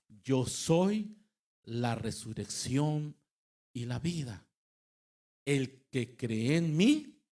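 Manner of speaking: loud, emphatic Bible reading, slowed on key words; preaching scripture. Acted. Preacher reading Jesus's words to the congregation, proclaiming. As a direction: proclaim the verse forcefully, drawing words out.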